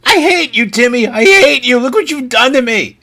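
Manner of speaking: put-on high, loud childish tantrum; whiny angry kid. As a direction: throw a kid's tantrum at a friend. Kid blames friend Timmy.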